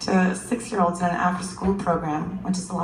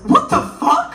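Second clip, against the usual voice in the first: high, loud squeaky what; kid's shocked outburst; 6 dB louder than usual. Retold or imitated. Six-year-old Darius reacting, high loud outburst.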